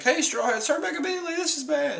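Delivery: very high, frantic warning cry; panicked Caribou. Retold or imitated. Caribou warning the Straw Hats to turn back, high and frantic. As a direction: shriek at them to flee. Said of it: "He's like".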